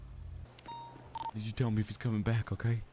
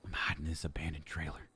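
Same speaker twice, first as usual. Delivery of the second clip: hushed voiceless whisper; 7 semitones lower, 9 dB quieter than usual. Acted. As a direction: whisper from the hiding spot. Hiding in trailer, whispering.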